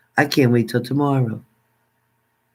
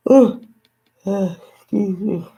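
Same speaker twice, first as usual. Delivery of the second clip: talking through a real yawn; 6 semitones higher than usual. Real, not acted. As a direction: let a high yawn swallow the words. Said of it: "I'm yawning over here".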